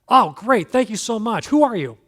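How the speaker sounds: loud, high, gushing relief; relieved excitement. Retold or imitated. Man on the branch hears a voice above, loud relieved gush. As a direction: burst out thankful that someone answered.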